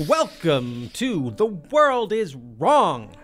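podcast host intro. Acted. Slow announcer-style show intro.

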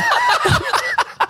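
high, squealing burst of laughter; shrieking laugh. Real, not acted. Laughing at the thief's nerve, shrieking laugh.

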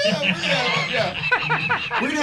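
high, fast laughing. Real, not acted. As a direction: break into a laugh while talking. Laughs at the dessert contest.

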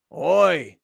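a loud, blunt Australian "Oi"; mock Australian greeting; imitating Aussie "oi". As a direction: bark out "Oi" like a transplanted Aussie. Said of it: "he goes".

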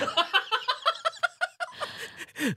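sudden high laugh; high burst of laughter. Real, not acted. Laughs at money question.

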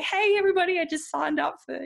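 high, gushing show-off post; mock excited announcement. Mimics bookkeepers bragging on Facebook.

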